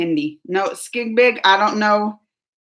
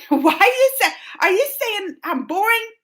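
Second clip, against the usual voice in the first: high, laughing disbelief; mock offended; 10 semitones higher than usual. Vlogger questioning Rahul, playfully offended. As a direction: act wounded through a laugh.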